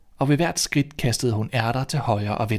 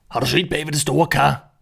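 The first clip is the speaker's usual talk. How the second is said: robber calling out; 4 semitones higher, 3 dB louder than usual. Acted. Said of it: "råbte en af de andre".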